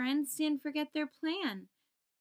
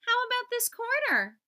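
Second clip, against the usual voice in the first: high, louder curious little voice; questioning; 11 semitones higher, 5 dB louder than usual. Acted. Little Q offering the quarter, high and loud. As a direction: ask it brightly, hopeful. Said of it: "Little Q questioned".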